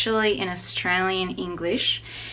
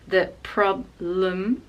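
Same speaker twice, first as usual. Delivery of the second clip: problem with stress on pro, uh in blem; slow careful problem; slow stress demo; slower than usual. Shown as a demonstration. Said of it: "the stress is on pro".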